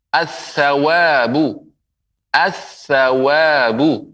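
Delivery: "as-sawabu" slowly with a doubled th sound, no l; slow teacher's model of "as-sawabu", lam silent; lam merged into tha. Shown as a demonstration. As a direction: draw out "as-sawabu", holding the th and long a. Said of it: "alif lam melebur ke huruf tha".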